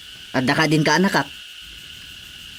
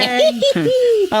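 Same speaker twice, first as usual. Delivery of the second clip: big shrieking laugh; high burst of laughter. Real, not acted.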